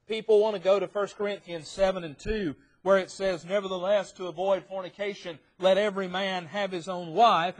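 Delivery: forceful preaching. Real, not acted. Preacher citing First Corinthians on marriage, forceful preaching. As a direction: drive the reading home from the pulpit.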